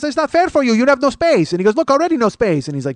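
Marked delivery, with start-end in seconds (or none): 0.0-1.5 high-pitched, louder complaint; insistent seatmate. Retold or imitated. Passenger explaining outburst to comedian, insistent seatmate. 1.8-2.7 higher, louder fussing; insistent seatmate. Retold or imitated. Passenger pointing at crowded seat, insistent seatmate.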